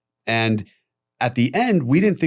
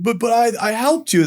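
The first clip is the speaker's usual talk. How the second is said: pleading protest; 12 semitones higher than usual. Retold or imitated. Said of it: "And I was like".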